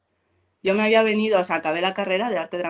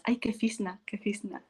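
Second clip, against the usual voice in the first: mocking schoolgirls; 2 semitones higher, 8 dB quieter than usual. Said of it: "como para meterse conmigo".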